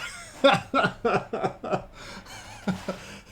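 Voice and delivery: long, high wheezing laugh; helpless real laughter. Real, not acted. Streamer remembers that kid with that goat, laughing helplessly. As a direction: laugh until the words give out.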